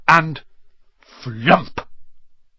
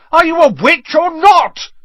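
very high, loud yell; bellowing; 8 dB louder than usual. A narrator's reading. Ron reminds Hermione she has magic, bellowing. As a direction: roar it in desperate exasperation. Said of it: "Ron bellowed".

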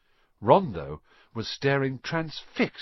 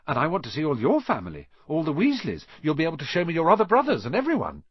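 fast, louder eager rush; 4 semitones higher, 4 dB louder, faster than usual. Acted. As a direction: tumble the words out in excitement. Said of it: "Ron said eagerly".

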